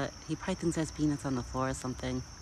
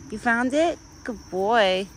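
praising pet talk; 5 semitones higher, 3 dB quieter, slower than usual. Real, not acted. Praising her deer.

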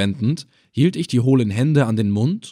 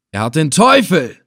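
loud, higher frightened scream; 5 semitones higher, 6 dB louder than usual. Acted. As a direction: cry out in superstitious dread. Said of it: "schrie Ibn Assel". (laut aufschreien)

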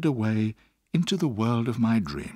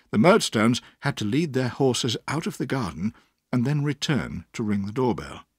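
plain reading voice

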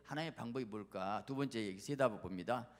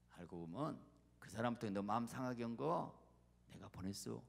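low, softened revelation; God's confiding voice; 3 semitones lower than usual. Acted. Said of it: "하나님 뭐라고 하는지 아세요?".

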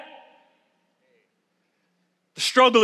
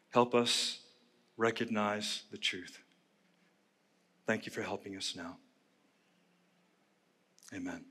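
quiet prayer; 9 semitones lower, 15 dB quieter than usual. Acted. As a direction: pray gently over the room. Opening prayer.

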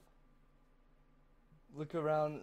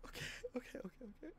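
breathless whisper; 3 semitones higher, 26 dB quieter, much faster than usual. Real, not acted. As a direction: whisper to steady yourself. Calming self, sneaking quietly.

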